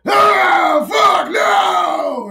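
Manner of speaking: put-on very high, loud baby scream; newborn's scream. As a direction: wail like a newborn in horror.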